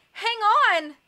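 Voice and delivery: high, loud shout; desperate call. A narrator's reading. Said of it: "Klaus called desperately".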